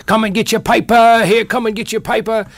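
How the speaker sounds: high, loud Cockney street cry selling papers; Cockney newsboy call. Retold or imitated. Actor recalling his one radio line, a loud Cockney newsboy cry. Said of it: "a Cockney newsboy".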